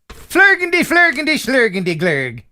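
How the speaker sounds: sing-song fake Swedish, starting high and tumbling down; mock Swedish. Host pretends to read a letter from Sweden, mock Swedish. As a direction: gibberish Swedish, loud high start falling away. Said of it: "didn't know you spoke Swedish".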